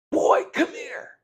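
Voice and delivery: demeaning summons, high and loud; contemptuous call. Retold or imitated. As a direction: bark it down at him like a master.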